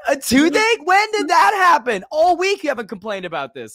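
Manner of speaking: high, loud, shouted disbelief; incredulous outburst. Retold or imitated. Commentator to promoter over a sudden toothache, incredulous shouting. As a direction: yell it sky-high, outraged and incredulous, laughter near the surface.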